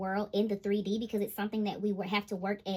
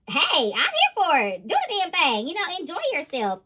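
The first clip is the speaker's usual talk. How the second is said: cheering on; 6 semitones higher, 8 dB louder than usual. Retold or imitated. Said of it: "And I'm just like".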